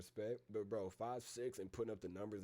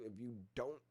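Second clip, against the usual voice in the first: usual speaking voice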